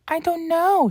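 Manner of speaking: very high-pitched puzzled coach; high little squeak of a voice; Annie. Acted. Coach answering her companion, high puzzled voice. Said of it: "said Annie".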